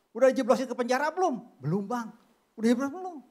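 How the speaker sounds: pushy retold nagging. Preacher retelling how he kept pestering his friend, pushy. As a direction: hound the friend for news. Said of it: "Gua cecer terus sama temen gua".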